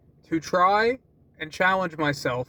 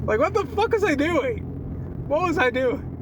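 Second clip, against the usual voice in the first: much higher, disbelieving self-question; incredulous; 9 semitones higher than usual. Real, not acted. Laughing in disbelief at how he acted at work, higher. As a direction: ask it in disbelief at yourself.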